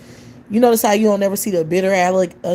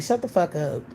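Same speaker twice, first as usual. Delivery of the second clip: ordinary delivery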